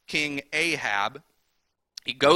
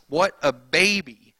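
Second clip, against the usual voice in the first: a scornful dig, a little louder; mocking scorn; 4 dB louder than usual. Real, not acted. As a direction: sneer at the sulking king. Mocking the sulking king.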